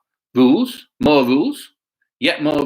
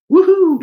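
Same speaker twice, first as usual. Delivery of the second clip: high, loud playful whoop; joyful woohoo; 8 dB louder than usual. Pretend. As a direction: whoop like a frisky calf. Calves running in the picture.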